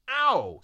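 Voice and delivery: loud, high "ow"; pained yelp. Acted. Tree kicked by Eliza, loud pained yelp.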